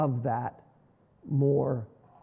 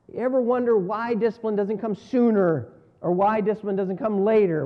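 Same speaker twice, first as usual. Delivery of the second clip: usual speaking voice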